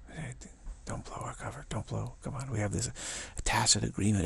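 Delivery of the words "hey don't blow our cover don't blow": softer, half-whispered plea not to give the game away; hushed plea. Pretend. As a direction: hush it like a conspirator. Mock hushed plea to others.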